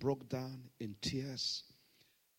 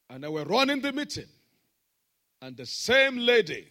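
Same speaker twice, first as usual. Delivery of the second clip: louder, higher, varied pace; preaching; 6 semitones higher, 8 dB louder than usual. Acted. Pastor telling the congregation about the lady, raised. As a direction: build the story up.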